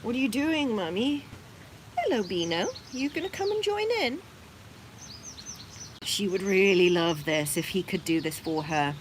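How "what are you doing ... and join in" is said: higher, curious bunny voice; voicing the male bunny. Beano asking Mummy what she's doing, higher puzzled voice. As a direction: play the puzzled buck. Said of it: "he's like".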